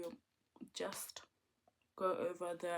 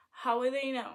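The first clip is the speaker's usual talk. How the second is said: teasing; 4 semitones higher, 8 dB louder than usual. Acted.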